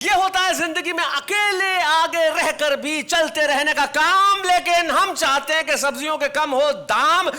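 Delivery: loud rhyming announcer flourish; poetry show anchor closing the segment; stage host rhyme. Acted. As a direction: build it up to a grand break. Host's rhyming sign-off.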